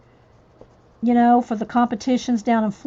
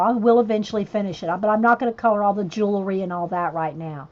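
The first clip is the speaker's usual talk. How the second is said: ordinary delivery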